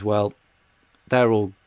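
ordinary talk

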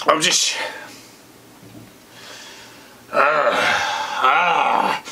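hammed-up choked sobs on "ah"; mock crying. Ranting vlogger chokes up over wasted beer, mock sobbing. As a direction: fake a sob between words, not quite real. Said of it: "I'm starting to get emotional right now".

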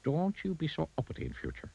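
high-pitched goblin caution; warning goblin. A narrator's reading. Goblin parts from Mister Uppity, high warning. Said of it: "warned the goblin".

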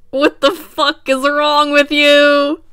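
slow, loud, high outburst; loud shout. Real, not acted. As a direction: yell it in disbelief. Reacting to shirt text.

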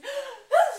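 high, loud gasp of delight. Retold or imitated. Friend spotting her tiny dogs, gasping with delight. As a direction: gasp with glee at the little dogs. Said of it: "she goes".

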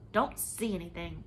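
ordinary delivery

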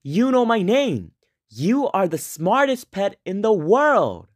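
put-on high, amazed little-boy voice; a boy who can't believe his ears; lightly voiced wide-eyed kid; surprised boy. A narrator's reading. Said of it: "The boy was surprised".

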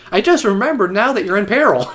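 high, loud put-on voice; mocking imitation. Player imitating the forgetful helper, loud high mock warning. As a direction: mimic a too-late warning, hammed up. Mocks late-remembering game character.

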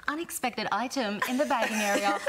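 light checkout machine voice; even automated warning voice; self checkout prompts. Acted. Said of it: "they want me to do it in real life".

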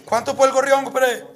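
shouted, pushy haggling; loud demand. Acted. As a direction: bark the price question. Moreno pide el gorrión, gritando. (exigencia a gritos)

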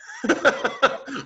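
high-pitched chuckling; quick run of high laughs. Real, not acted. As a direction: burst into light, high laughter. Teacher laughs during class drill.

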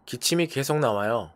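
ordinary delivery

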